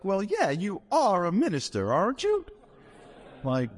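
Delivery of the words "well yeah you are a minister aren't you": high, rising Georgia lady, climbing at the end; sweetly puzzled caller from Georgia; Southern lady voice. Retold or imitated. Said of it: "She's like".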